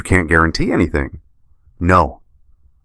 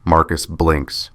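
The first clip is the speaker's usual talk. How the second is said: ordinary delivery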